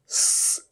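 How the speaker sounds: स as a soft unvoiced hiss; whistling स; quiet hissed स. Shown as a demonstration. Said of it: "सी".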